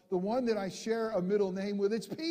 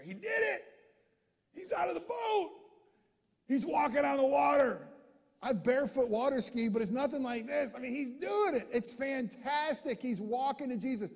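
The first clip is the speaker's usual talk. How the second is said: excited; 6 semitones higher than usual. Real, not acted. Preacher excited by Peter walking.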